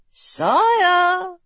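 high, drawn-out nudge; prodding nurse. A narrator's reading. Nurse prodding the silent king, high insistent call. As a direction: prod with a long, high call. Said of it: "the nurse prodded".

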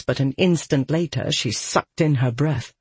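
usual speaking voice

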